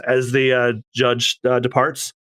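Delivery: ordinary delivery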